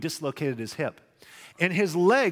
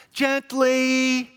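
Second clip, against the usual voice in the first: gently said much higher and louder; high pleading gently; 9 semitones higher, 6 dB louder than usual. Shown as a demonstration. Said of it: "you want them to be what?".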